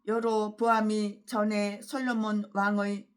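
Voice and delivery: slow, measured pulpit reading of the verses; scripture reading. Acted. Pastor reading the text of Chronicles to the congregation, slow solemn reading. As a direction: read the Bible passage slowly and solemnly.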